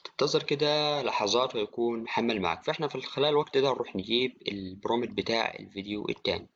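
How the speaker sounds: normal talking voice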